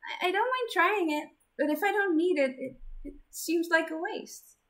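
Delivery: put-on timid gentle girl; shy girl voice. Zenith voiced high.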